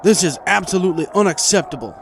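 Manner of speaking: high, loud outraged protest; indignant shopkeeper. A narrator's reading. Marty protests loudly to the princess, outraged. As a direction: bristle with offended outrage. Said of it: "were Marty's first words".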